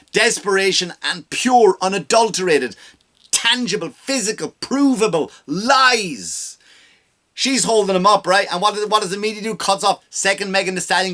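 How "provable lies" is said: provable lies drawn out slowly, hit hard; stressed slow punchline. Real, not acted. Host ranting at the media, slowly hammering lies. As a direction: lean on each word slowly.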